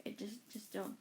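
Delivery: hushed plea to stay out; hushed warning. Retold or imitated. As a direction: quietly warn the kids off. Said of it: "They're like".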